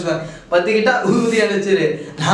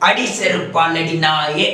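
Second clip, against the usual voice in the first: slow film villain line; 2 semitones lower, slower than usual. Acted. Quoting film insult.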